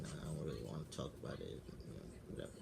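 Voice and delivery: soft, fast mumble trailing off; brushing it off. Retold or imitated. To a coworker asking what's wrong, a soft, quick dismissive mumble. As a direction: wave the coworker off quickly and quietly. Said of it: "I'm like".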